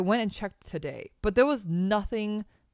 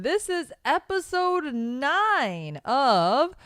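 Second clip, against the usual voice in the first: slow, raised announcer delivery; drawn-out show intro; 9 semitones higher, 7 dB louder, much slower than usual. Acted. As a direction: stretch the show title slowly like an announcer. Host announces show name.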